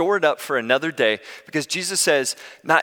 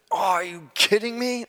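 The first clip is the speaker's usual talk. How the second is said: high-pitched retold exasperation; put-on high, incredulous complaint; exasperated disbelief; 7 semitones higher than usual. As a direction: act out disbelief at the traffic, pitched up. Retold disbelief at gridlocked traffic.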